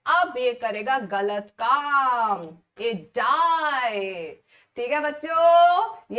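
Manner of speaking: drawn-out ये डाई, a loud slow ठीक है बच्चों; slow it right down for the punchline; slow dramatic emphasis. Shown as a demonstration. Teacher driving the point home to her students, slow and dramatic.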